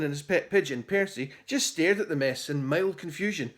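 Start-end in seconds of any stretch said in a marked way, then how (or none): none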